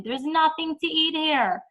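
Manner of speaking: half-hearted imitation of a screaming kid; mock whiny scream. Kid at open fridge complaining to parent, mild mock scream. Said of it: "open the fridge and scream".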